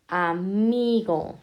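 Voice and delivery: aMIgo, slow with MI held long; stress on middle syllable. Shown as a demonstration. Teacher marking llanas, amigo stressed slowly on MI. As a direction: stretch the MI in amigo. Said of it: "en la penúltima".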